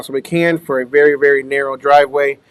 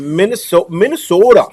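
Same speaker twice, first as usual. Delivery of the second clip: playful Minnesota twang, pitched up; Minnesota accent; 4 semitones higher than usual. Retold or imitated. Greeting a viewer from back home, playful Minnesota accent. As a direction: lean into the Minnesota accent on the name. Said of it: "the Minnesota sound a little funny".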